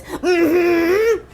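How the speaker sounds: high-pitched, drawn-out knowing hum; big mm-hmm. Acted. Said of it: "then in huge letters".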